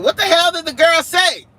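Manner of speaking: shouting the chat's confusion, played up; mock shout. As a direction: yell it as the puzzled chat. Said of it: "I know y'all like".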